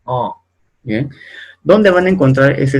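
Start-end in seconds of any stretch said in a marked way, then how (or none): 0.1-0.3 nasal A, held through the nose. Shown as a demonstration. Teacher to students, repeating the nasal A. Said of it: "Se pronuncia A".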